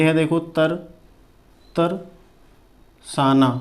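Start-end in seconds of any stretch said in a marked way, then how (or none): 1.8-3.5 तरसाना drawn out very slowly; slow spelled-out तरसाना; slow dictation. Shown as a demonstration. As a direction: stretch तरसाना syllable by syllable. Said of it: "तरसाना इस तरह से".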